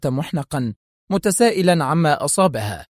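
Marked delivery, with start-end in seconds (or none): none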